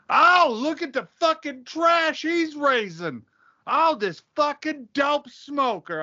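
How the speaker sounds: high, slow, scandalized whine; mock outraged conservative. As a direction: drag it out high like an appalled prude. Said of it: "They're like".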